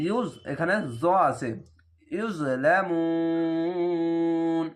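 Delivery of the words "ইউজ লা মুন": মুন held very long, three alifs, at the verse end; three-alif stretch. Shown as a demonstration. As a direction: draw out মুন for three alif lengths and stop. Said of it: "তিন আলিফ টেনে কেন পড়লাম".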